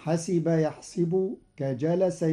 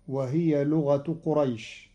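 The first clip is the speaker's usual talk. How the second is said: ordinary delivery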